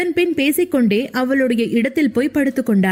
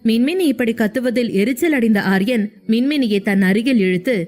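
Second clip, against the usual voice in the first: ordinary delivery, nothing marked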